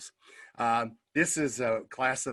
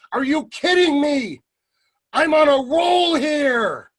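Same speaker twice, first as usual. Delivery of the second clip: high, loud, drawn-out outburst; indignant shout; 8 dB louder, slower than usual. Retold or imitated. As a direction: yell it in mock outrage, carried away. Retold outburst to complaining teacher.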